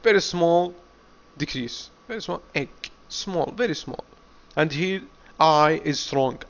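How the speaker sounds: ordinary delivery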